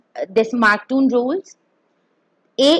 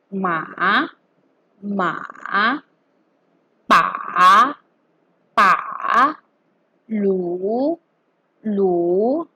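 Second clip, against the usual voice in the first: MA, PA, LU each dropping low, then rising; long V-shaped pitch on each "ma, pa, lu"; dipping third tone; 3 semitones lower, much slower than usual. Shown as a demonstration. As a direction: curve "ma", "pa", "lu" down and back up. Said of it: "making a V or a curve".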